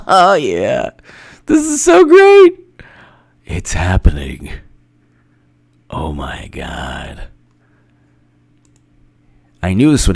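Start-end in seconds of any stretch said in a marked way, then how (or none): 1.5-2.6 very high loud squeal; high shrieking delight; excited squeal. Real, not acted. 3.5-4.5 low gleeful whisper; hushed excited whisper. Real, not acted. Whispered glee. 5.9-7.3 much lower, softer, slow; stunned low murmur; hushed. Real, not acted. Hushed disbelief.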